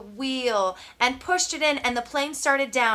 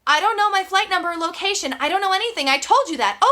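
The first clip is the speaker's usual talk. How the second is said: loud, much higher and fast, exasperated; frustrated shout; 8 semitones higher, 7 dB louder, faster than usual. Acted. Brian shouting his frustration at the radio operator. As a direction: snap back in desperation.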